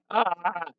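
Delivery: high, held mock scream; playful high "aah". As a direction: scream for show, not at full volume.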